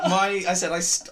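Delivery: normal talking voice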